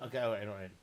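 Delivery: ordinary delivery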